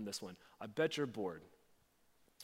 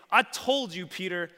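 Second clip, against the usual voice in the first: loud, raised-pitch angry accusation; harsh reproach; 5 semitones higher, 7 dB louder than usual. Acted. Mock angry Jesus accuses Peter of denial, harsh. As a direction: scold him hard for letting you down. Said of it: "Jesus isn't saying".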